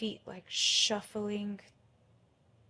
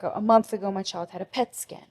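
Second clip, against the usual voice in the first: ordinary talk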